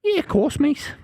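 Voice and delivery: mock Liverpool accent on request; Scouse accent. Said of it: "Can you do a Scouse accent?".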